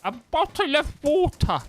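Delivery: high, slow hammed-up British accent; exaggerated British accent. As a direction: ham up a British accent, pitched high and loud. Mock proof of being British.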